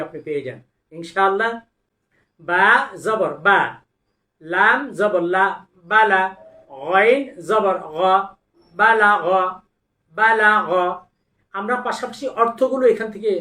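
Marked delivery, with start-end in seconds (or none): 2.4-10.7 loud, deliberate syllable recitation; Quran recitation drill. Shown as a demonstration. Quran teacher reciting syllables for students to repeat.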